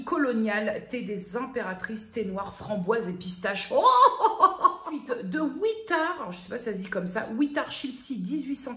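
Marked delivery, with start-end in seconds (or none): 3.9-4.8 a sudden high, loud laugh that falls away; burst of laughter. Real, not acted. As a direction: let the laugh burst out and drop. Laughs at a tea's name. (éclat de rire aigu)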